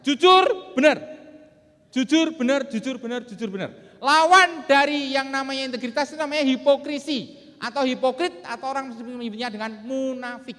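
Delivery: loud, high-pitched motivational delivery; rousing seminar declamation. Real, not acted. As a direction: drive each point home to the hall. Speaker declaims slogans to the hall.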